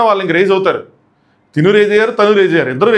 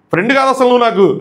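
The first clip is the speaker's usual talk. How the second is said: retold yell; 7 semitones higher than usual. Madhuri retold shouting at Sanjana, high mock yell. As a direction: cry it out pitched high. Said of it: "గట్టిగా అరుస్తుంది".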